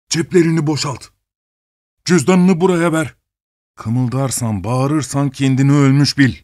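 lightly voiced menacing thief; gruff robber voice. A narrator's reading. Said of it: "boğuk ses ikinci bir kumanda verdi".

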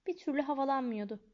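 plain reading voice

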